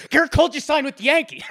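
high, fast, laughing excitement; giddy excited. Pretend. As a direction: burst out like a thrilled kid. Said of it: "like I was just so genuinely excited".

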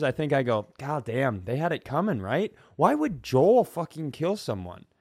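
normal talking voice

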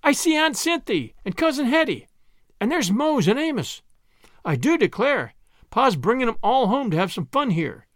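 high-pitched, eager exclaiming; excited cry. A narrator's reading. Prue recognizes one familiar face after another, crying out excitedly. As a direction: cry out each discovery, starting high. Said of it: "cried Prue".

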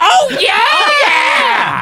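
high, loud drawn-out oh yeah; fake interest reaction.

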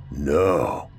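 low, dark, dreading "No"; a hollow, sinking no. Acted.

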